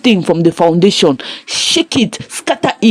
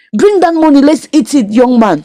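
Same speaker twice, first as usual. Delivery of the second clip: higher-pitched, mildly demanding quote; lightly imitated scolding friend; 6 semitones higher than usual. Dan tells his broke friend to bring money, mildly scolding, higher voice. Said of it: "And Dan was like".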